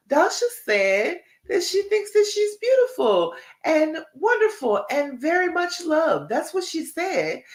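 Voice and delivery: high, bright voice of your own brain arguing back; the brain talking back. Retold or imitated. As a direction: play the inner voice reassuring itself, pitched high. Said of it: "go back and say".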